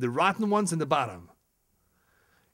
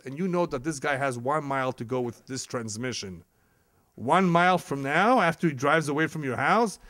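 usual speaking voice

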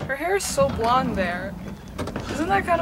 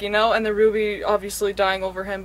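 ordinary talk, nothing marked

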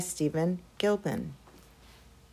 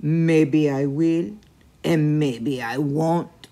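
slow, loud, drawn-out monster taunt; teasing chupacabra; 3 semitones lower, 6 dB louder, much slower than usual. A narrator's reading. Chupacabra teases the goat, slow loud taunt. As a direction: toy with the little goat.

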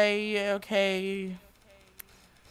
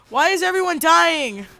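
excited outburst; 7 semitones higher, 6 dB louder than usual. Real, not acted. Chaos in game.

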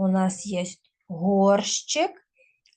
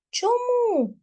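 mock puzzled; 13 semitones higher than usual. Teacher asks a leading question.

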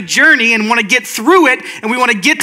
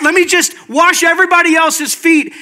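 higher, insistent offers; over-eager servant; 6 semitones higher than usual. Acted. Servant begging to pour out love on everyone, over-eager. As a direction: plead to serve, pitched up.

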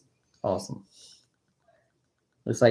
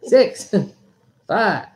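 excited count; 7 semitones higher, 3 dB louder than usual. Acted. Countdown shout.

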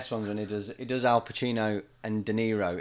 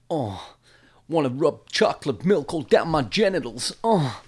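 higher, louder crooning rapper impression; mock seductive crooning; 4 semitones higher, 7 dB louder than usual. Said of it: "impressions of [name]".

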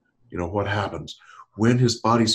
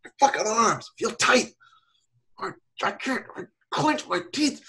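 put-on teen describing tension; 11 semitones higher than usual. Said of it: "He's like".

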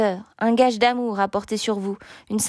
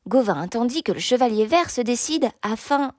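plain reading voice

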